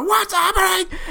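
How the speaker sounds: high, startled goblin cry; confused goblins. Acted. GM voices confused goblins, high and startled. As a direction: goblins panicking and asking what's happening. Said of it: "the goblins go".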